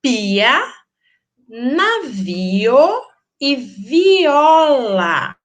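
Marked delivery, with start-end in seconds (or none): 1.5-5.4 "navio e viola" stretched slowly, vowels held apart; slow, louder-edged "navio e viola" with vowels separated; syllables drawn apart. Shown as a demonstration. Teacher showing pupils how vowels separate, syllables drawn apart. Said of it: "Vejam que a gente separa".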